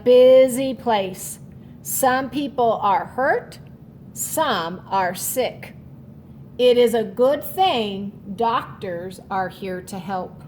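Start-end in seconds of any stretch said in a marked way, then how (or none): none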